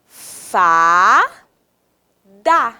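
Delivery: long rising "Fá", pause, then "da"; slow two-beat syllable demo of "Fá, da"; separated syllables. Shown as a demonstration. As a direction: stretch and separate "Fá, da". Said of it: "eu tenho dois sons diferentes".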